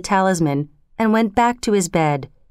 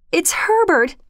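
high, eager cry of a mother hoping; wife's high excited cry; 10 semitones higher than usual. Acted. Wife hearing knocks, crying out for her son, high and eager. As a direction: she cries out that her son is home. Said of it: "she cried".

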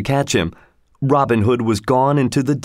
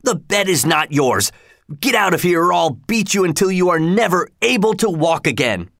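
higher, lightly voiced angry forester; angry threat; 6 semitones higher than usual. A narrator's reading. Angry forester threatens young Robin, higher. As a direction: threaten the boy, hot with ale.

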